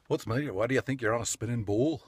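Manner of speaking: put-on a light, half-done Scottish accent; a hint of Scottish accent, joking; mock Scottish accent. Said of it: "I'll try not to slip into a Scottish accent".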